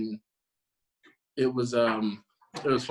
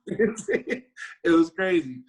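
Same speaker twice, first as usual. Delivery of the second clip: laughing; 6 semitones higher, 5 dB louder, much slower than usual. Real, not acted. Laughing while recalling.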